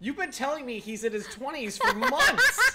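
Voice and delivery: high, loud laughing accusation; laughing outrage. Real, not acted. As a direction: laugh in disbelief.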